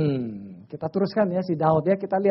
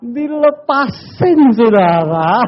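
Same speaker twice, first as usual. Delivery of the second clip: slow, stretched and louder; drawn-out punchline; 3 semitones higher, 11 dB louder, much slower than usual. Real, not acted. Preacher revealing to congregation he was released, drawn out. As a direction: stretch the word for effect.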